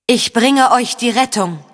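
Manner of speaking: high, forceful proclamation; shrill lying spirit. A narrator's reading. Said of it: "das schrille Organ des Lügengeists". (schrill und angestrengt verkündet)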